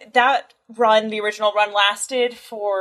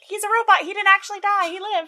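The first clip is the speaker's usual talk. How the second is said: playful high-pitched explaining voice; mock-earnest high voice; goofy high voice; 10 semitones higher than usual. Explaining why the robot survived.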